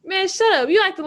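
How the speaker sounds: loud high snap back at a friend; annoyed shout. Acted. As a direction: shout him down, half joking. Friends trading insults.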